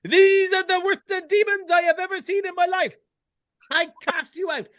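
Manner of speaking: over-the-top priest casting out demons; hammy exorcist priest. Retold or imitated. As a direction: camp it up like a horror-film exorcist. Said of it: "it's all".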